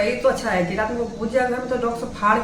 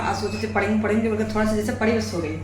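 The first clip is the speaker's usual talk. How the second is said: normal talking voice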